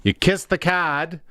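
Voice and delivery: put-on a rough, half-hearted Newfoundland accent; playful put-on Newfoundland accent; bad Newfoundland accent. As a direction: toss on a terrible Newfoundland accent for fun. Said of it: "That was a terrible Newfoundland accent".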